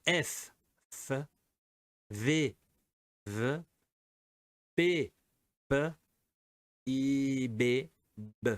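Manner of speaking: slow, separate F V P B sounds; teacher models each letter sound for students, clear and slow; repeated letter sounds. Shown as a demonstration. Teacher to students, a slow clear model of each letter. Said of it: "Je répète".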